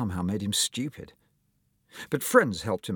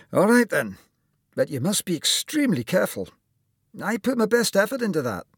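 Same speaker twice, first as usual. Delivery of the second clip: higher-pitched Scottish surgeon; Scottish accent; 11 semitones higher, 4 dB louder than usual. Acted. Surgeon warning his patient to be careful, Scottish accent. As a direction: caution him in an emphatic Scottish lilt. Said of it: "with just a hint of a Scottish accent".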